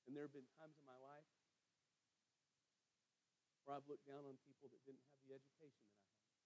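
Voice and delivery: quiet confession. Real, not acted. Preacher confessing his own past prejudice to congregation, hushed. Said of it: "and this is confession".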